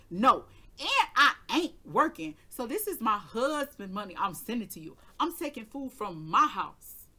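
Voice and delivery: loud, heated rant at the brother; furious telling off. Retold or imitated. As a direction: let him have it, voice rising.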